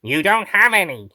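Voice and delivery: out of character, in a natural register; wrong character voice. Acted. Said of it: "That's the wrong voice".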